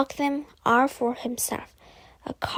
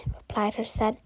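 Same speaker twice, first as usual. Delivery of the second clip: plain reading voice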